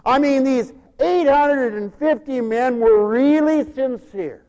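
loud, slow, high proclamation; full-force preaching. Acted. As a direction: belt it out slowly from the pulpit.

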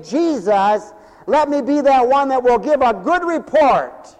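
loud, high-pitched preaching at full force. Acted. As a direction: preach it out to the back pews.

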